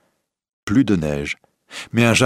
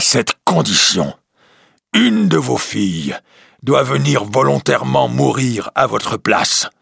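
higher, louder monster voice; beast voice; 5 semitones higher, 4 dB louder than usual. Acted. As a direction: correct him with menace like a beast. The beast sets its condition. (la bête reprend le marchand, voix forte et aiguë)

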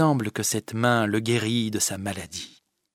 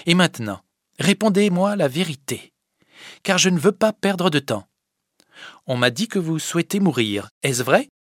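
put-on higher, louder, demanding girl; stern young Fadette; 7 semitones higher, 5 dB louder than usual. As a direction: press him for the truth. Fadette questionne Sylvinet. (la petite fadette, sévère et pressante)

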